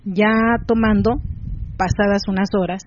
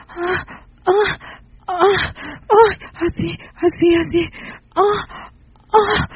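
high, moaning pleas broken by cries; 7 semitones higher than usual. A narrator's reading. Rosa moaning to the men in a high voice. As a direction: keep it high and moaning, asking for more. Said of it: "Solo retorcía los ojos ella y decía".

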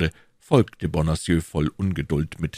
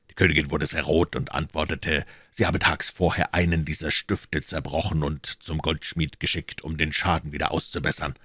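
usual speaking voice

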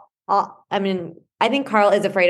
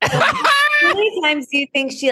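laughing; 11 semitones higher than usual. Real, not acted. Laughing at the photo story.

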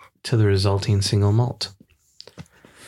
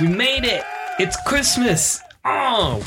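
excited; 11 semitones higher than usual. Real, not acted. Celebrating the Christmas special.

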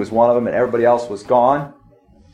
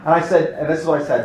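usual speaking voice

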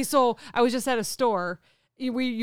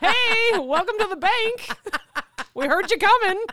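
higher, lightly acted teller voice, laughing; amused mock welcome; bank teller greeting; 8 semitones higher than usual. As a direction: play a wide-eyed teller greeting through laughter. Acts out amused bank staff greeting.

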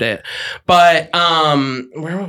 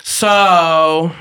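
ordinary delivery

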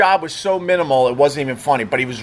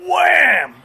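high, drawn-out shouted exclamation; big storytelling slam; sudden impact; 11 semitones higher, 4 dB louder than usual. Retold or imitated. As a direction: shout it like a sound effect, falling in pitch. Acting out rod bending suddenly.

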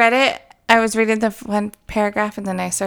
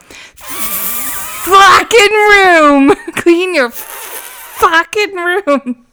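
slow, drawn-out mock yell, much higher and louder; imitating mom's yell; 10 semitones higher, 5 dB louder, much slower than usual. Daughter imitates her mad mom yelling at the kids to clean up, a hammed-up drawn-out yell. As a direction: stretch each word like an angry mom about to lose it. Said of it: "She'd be like".

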